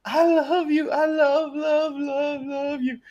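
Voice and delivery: very high, crying-like dog voice; high whimpering pup saying I love you; imitating dog saying I love you. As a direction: whine it out like a dog begging you to stay. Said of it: "you would hear that dog say".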